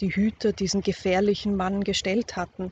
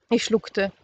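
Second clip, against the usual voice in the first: ordinary delivery, nothing marked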